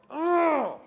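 loud, high, drawn-out ugh of dismay; disgusted groan. Pretend. Dieter groaning at the scale, loud high ugh. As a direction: groan at the scale like it betrayed you.

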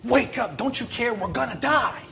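high, urgent cry of alarm; panicked cry. Acted. Disciples waking Jesus in the storm, panicked. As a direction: shout him awake in acted panic.

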